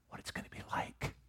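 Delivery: tender whispered wish. Acted. Jesus longing for Mary and Martha, whispered. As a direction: breathe it out as a whisper.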